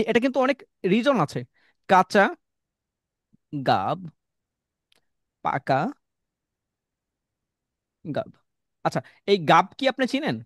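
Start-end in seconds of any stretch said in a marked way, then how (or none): 1.9-8.3 কাঁচা গাব পাকা গাব said word by word with long pauses; slow demo. Shown as a demonstration. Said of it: "আমি কেন বলছি".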